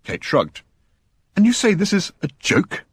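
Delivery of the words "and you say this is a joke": demanding, high and disbelieving; can't believe it's a joke; incredulous. A narrator's reading. Standish, stunned by the joke, demands incredulously. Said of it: "demanded Standish incredulously".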